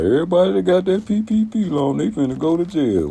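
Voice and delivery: high, mocking sing-song gossip voice. Mocking a gossiper who says loan takers will go to jail, high taunting voice.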